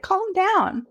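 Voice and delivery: high, teasing calm down; mock exasperation. Teasing prudish people to calm down, high mock exasperation. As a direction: roll your eyes at the fuss. Telling the prudes off.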